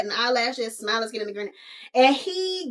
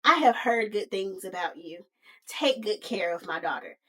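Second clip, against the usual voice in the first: lightly voiced father, dropping lower and softer; deadbeat daddy; 4 semitones lower, 6 dB quieter than usual. A father greeting his daughter's suitor, mildly low and subdued. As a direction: let the father's lines sink quietly. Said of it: "Deadbeat Daddy is like".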